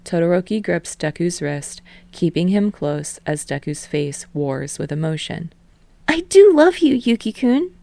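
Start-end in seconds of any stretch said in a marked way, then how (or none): 6.1-7.7 much higher, torn, pleading voice; a boy confessing love while holding back; emotional confession. Acted. Deku acting Daiki in a teen drama.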